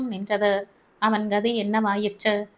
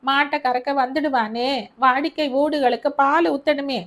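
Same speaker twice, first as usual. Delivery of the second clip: higher, louder complaining tale; agitated old mother; 4 semitones higher, 3 dB louder than usual. Acted. Mother telling Albert her complaint, higher and agitated. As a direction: rattle off her grievance.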